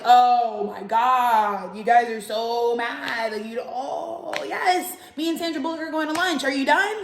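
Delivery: mock exasperated. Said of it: "I just had to, like".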